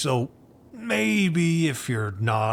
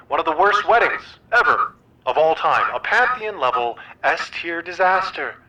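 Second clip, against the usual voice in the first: usual speaking voice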